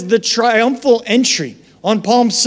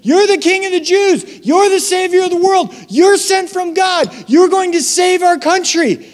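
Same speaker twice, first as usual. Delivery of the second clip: crowd screaming; 9 semitones higher than usual. Retold or imitated. Crowd hailing Jesus as king, high excited shouting. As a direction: shout your praise from the parade. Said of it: "they hear people screaming".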